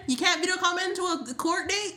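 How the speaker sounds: stunned, rising question; incredulous. Real, not acted. Incredulous at court rules.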